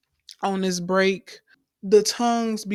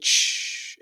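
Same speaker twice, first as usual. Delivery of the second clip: quick unvoiced "shh" noise; a hushing burst of static; imitated static. Imitating the static figure vanishing, a short shh noise.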